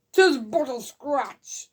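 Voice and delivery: slow, drawn-out quote; lightly acted knight. Reviewer quoting the famous wounded knight scene, slow and mock-heroic. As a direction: draw it out like a mock-heroic film line.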